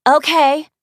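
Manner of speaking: high chirpy pretend voice; high fake voice. A narrator's reading. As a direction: squeak it up as the fake receptionist. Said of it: "the Kelsey voice says".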